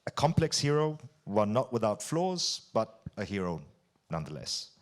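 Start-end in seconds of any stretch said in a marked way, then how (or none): none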